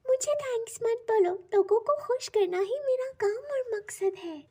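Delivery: very high-pitched enchanted helper; high storybook magic-creature voice; magical sandal's voice. A narrator's reading. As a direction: wave off thanks as a kindly magic object. Magical sandal figure speaking.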